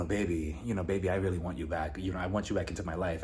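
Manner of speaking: low, soft imitation of a wooing ex; mock pleading. Ex begging her to come back, low soft plea. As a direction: croon it low and quietly.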